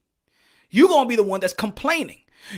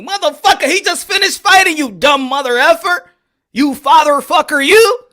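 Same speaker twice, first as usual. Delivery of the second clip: loud, higher, furious yelling; angry rant; 6 semitones higher, 7 dB louder than usual. Real, not acted. Vlogger yelling at fans who say Haney is ducking, furious. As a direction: yell at the fans in disbelief.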